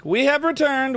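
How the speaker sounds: high, loud, grand proclamation; grand announcer. Pretend. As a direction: announce it like a herald.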